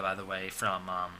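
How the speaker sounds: usual speaking voice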